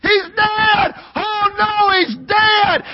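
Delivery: high, shouted cry of grief; wailing mother. Acted. Egyptian mother running from her home, wailing shout. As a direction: scream it like a mother who found her son. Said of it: "crying and wailing and screaming".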